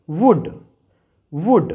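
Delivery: वुड said as wud, no l; silent l demo. Shown as a demonstration. Teacher showing students how to say वुड. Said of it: "तो इसे हम pronounce करेंगे".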